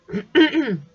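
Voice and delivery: loud throat clearing. Real, not acted. Reader with a cold clears her throat mid-reading, loud. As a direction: clear your throat hard, as if it has a cold in it. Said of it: "excusez-moi".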